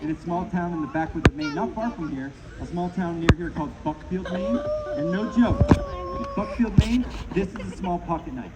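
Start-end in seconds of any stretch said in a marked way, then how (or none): 4.3-7.0 high, drawn-out announcement; showman's high slow build; dramatic reveal. Acted. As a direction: stretch in Buckfield up high and slow.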